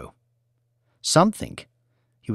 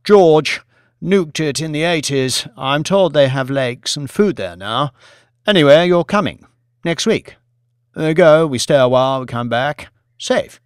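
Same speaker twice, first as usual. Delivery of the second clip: Billy, pushy and jovial; 3 semitones higher, 6 dB louder than usual. Acted. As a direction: brush off his doubts with loud cheer. Billy insists, loud and jovial.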